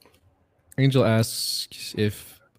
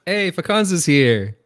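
high, loud, drawn-out hail; excited greeting; 12 semitones higher, 8 dB louder, much slower than usual. Real, not acted. Host hailing a newcomer to the stream, excited greeting. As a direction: greet them with a big raised cheer. Greeting someone who just arrived.

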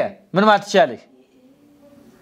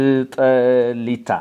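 drawn-out word; 5 semitones lower, much slower than usual. Shown as a demonstration. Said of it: "teacher models the Amharic word".

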